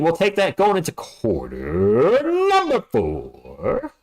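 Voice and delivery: slow, stretched announcer call; hammed-up slow arena announcer; announcer drawl. Announcer-style drawn-out call.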